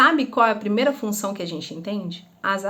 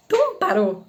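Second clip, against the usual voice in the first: put-on high puzzled kid's question; child's high voice; 9 semitones higher than usual. Pupil puzzled by the teacher's shout: high innocent question. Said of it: "Aí ele".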